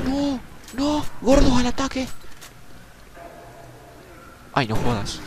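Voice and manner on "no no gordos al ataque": high, panicked battle cry; high-pitched shout, louder at the end; alarmed shout. Real, not acted. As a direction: yell a warning as they charge. Enemies suddenly rush the player.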